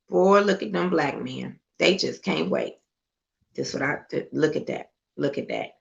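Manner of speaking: low, disgusted muttering under the breath; disgusted aside. Real, not acted. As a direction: shake your head and mutter it softly.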